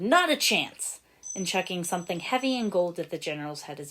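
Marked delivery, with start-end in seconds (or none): 0.0-1.0 higher, defiant shout. A narrator's reading. Small green blur shouting at the general, defiant. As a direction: yell it as you race past. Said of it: "with a shout of".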